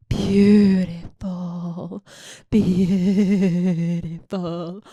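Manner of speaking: very slow, drawn-out relish; mock swooning. Host admiring an actor's body, mock swooning. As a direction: draw the word right out, swooning.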